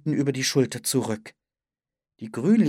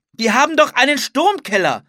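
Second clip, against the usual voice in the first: high, loud retort; shouted objection; 13 semitones higher, 9 dB louder than usual. A narrator's reading. Philipp counters Anne about the storm cellar, shouting. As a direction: argue back at a shout, pitched up. Shouted argument while tornado approaches.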